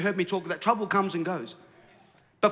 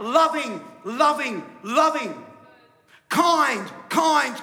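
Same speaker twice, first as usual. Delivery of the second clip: high, loud one-word chant; mock angelic yell, each word falling; 7 semitones higher, 6 dB louder, much slower than usual. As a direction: shout each word as a separate proclamation. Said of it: "why aren't they yelling out".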